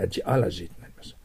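usual speaking voice

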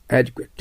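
teacher modelling Adequate; careful pronunciation demo. Shown as a demonstration. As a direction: model the word Adequate for students to repeat. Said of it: "発音してください".

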